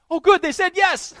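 very high, loud gleeful squeal; delighted kid. Retold or imitated. Kid who wore the parents down, delighted.

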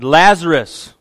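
loud call of the name. Acted. Preacher voicing Jesus calling Lazarus, a loud call. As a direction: call him out of the tomb.

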